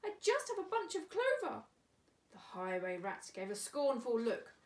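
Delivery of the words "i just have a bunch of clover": very high small rabbit voice; timid rabbit. Acted. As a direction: squeak it like a little rabbit. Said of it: "the rabbit replied".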